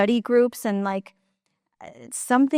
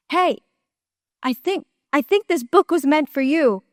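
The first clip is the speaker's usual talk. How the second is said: calling out from afar; 5 semitones higher than usual. Retold or imitated. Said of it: "she calls out from, like, the distance".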